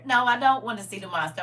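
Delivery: ordinary talk, nothing marked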